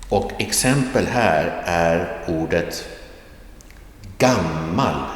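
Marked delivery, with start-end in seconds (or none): 4.2-5.1 "gammal" with the tone dropping from a stressed "gam"; falling tone accent. Shown as a demonstration. Teacher gives an example of accent two, falling tone on "gammal". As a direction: Swedish accent two on "gammal", pitch falling after the start. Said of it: "a falling tone or a dropping tone".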